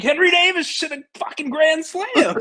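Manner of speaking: high-pitched re-enacted scream of excitement; excited screaming. Telling the wife about a grand slam, screaming excitedly. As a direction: scream the big news, voice shooting way up. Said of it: "are you screaming about".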